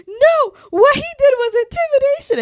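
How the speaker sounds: high, loud back-seat outburst; imitated screaming. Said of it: "screaming from the back seat".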